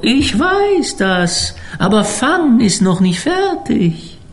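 put-on high, eerie old cook; slow, otherworldly ship's cook voice. A narrator's reading. Koch im Roman spricht. (hoher, unheimlicher Schiffskoch)

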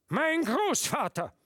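high, loud and slow, full of pride; loud declaration. A narrator's reading. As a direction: announce it proudly to the room. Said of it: "begann der Leutnant wieder".

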